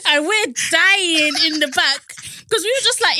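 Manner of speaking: talking through real laughter; laughing. Real, not acted. Telling her co-host the story, laughing through it. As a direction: still cracking up as she says it.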